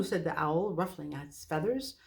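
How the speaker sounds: usual speaking voice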